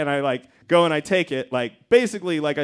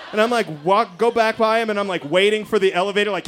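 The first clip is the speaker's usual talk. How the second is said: ordinary talk, nothing marked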